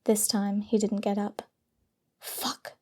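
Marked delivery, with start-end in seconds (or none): 2.2-2.7 breathy whispered swear; whispered curse. Acted. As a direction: hiss the curse under breath. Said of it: "she swore".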